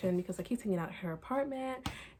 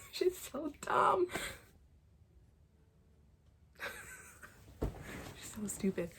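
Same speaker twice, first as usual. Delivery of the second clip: slow, laughing through the words; 8 semitones higher, much slower than usual. Real, not acted. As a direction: giggle it out, amused and fed up. Laughing at character.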